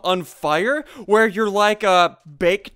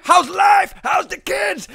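high, raised-voice taunt; loud high heckle; taunting call; 10 semitones higher, 6 dB louder, slower than usual. Real, not acted. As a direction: holler it mockingly at a rival. Calls out to camping opponent.

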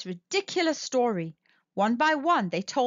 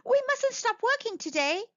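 squirrel voice; 11 semitones higher than usual. A narrator's reading. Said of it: "called Squirrel".

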